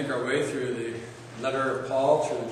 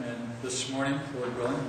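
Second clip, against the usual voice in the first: ordinary talk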